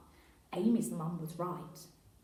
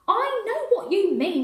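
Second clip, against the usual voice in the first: loud, high angry shout; 12 semitones higher, 12 dB louder than usual. Acted. Inspector losing his temper with constable, angry shout. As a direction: snap it out, losing your temper. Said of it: "shouted D C I Bryant".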